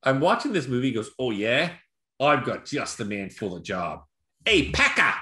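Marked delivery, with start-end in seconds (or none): 1.2-4.0 slow, lower Cockney movie villain; imitated Irish Cockney accent. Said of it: "it's a real Irish Cockney accent". 4.4-5.1 high Cockney-style call; imitated yell. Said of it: "I hear somebody yell Pecker".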